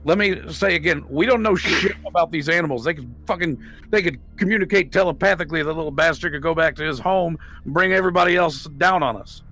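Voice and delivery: normal talking voice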